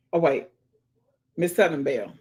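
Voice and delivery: ordinary talk, nothing marked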